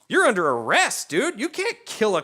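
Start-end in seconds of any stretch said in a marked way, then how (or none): none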